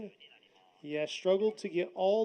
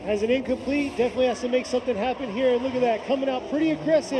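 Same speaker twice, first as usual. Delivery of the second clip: raised, louder excited race call; live play-by-play; 5 semitones higher, 6 dB louder than usual. Real, not acted. Commentator calling Goto's second run, excited play-by-play. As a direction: push the energy as the car struggles.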